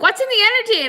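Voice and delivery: hammed-up fretful question; mock anxious question. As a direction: imitate worried followers asking. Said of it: "they're like".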